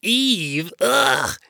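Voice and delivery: loud appalled EVE, then a gagging ugh; retching disgust. A narrator's reading. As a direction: say EVE as if it makes you retch. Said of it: "let out a retching sound".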